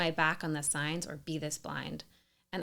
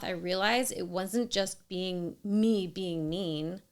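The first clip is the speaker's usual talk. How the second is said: normal talking voice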